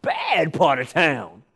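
a hint of a menacing Southerner; imitating a local. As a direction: drag out "bad part of town". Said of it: "he said".

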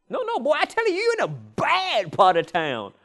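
a hint of a menacing Southerner; imitating a local. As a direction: drag out "bad part of town". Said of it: "he said".

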